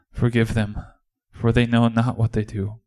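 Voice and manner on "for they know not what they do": ordinary talk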